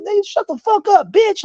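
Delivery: very high-pitched mock yell; heated re-enactment, voice shooting up; retold furious comeback. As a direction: lash back in acted outrage.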